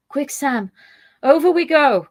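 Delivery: loud, urgent call to jump; urgent cry. Acted. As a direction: shout it to rush him over the edge. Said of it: "cried Frodo".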